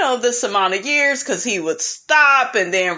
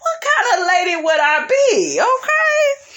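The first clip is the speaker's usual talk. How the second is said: hammed-up cry of a cheated woman; mock outraged wife; 8 semitones higher than usual. Cheated wife venting to the camera, mock outraged wail.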